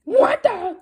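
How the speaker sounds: loud, high shocked yell; startled scream. Acted. Principal finding ketchup, loud high shriek. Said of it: "screamed Mister Crow".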